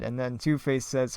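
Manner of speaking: ordinary talk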